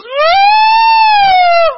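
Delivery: high, loud wail of an upset mother; mother crying out over her dead mockingbird, high and loud; shocked mom. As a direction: shriek like a mom who just lost her bird. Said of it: "and she goes".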